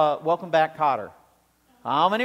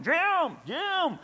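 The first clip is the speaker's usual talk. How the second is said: high, drawn-out call of Jim; calling up at a friend's window; calling out a name; 11 semitones higher than usual. Retold or imitated. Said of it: "keep yelling".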